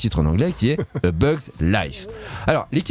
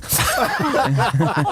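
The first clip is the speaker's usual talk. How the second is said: high laugh breaking out; burst of laughter; 9 semitones higher than usual. Real, not acted. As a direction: laugh out at the joke. Laughing at film translations. (éclat de rire aigu)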